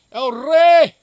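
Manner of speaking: loud, high hail; calling out. Real, not acted. Streamer loudly hailing a viewer who just arrived. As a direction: shout the name to greet him.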